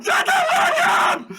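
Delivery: slow, stretched-out scream at full volume; furious yelling. Pretend. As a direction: scream each word high and loud. Acted yelling at the captive.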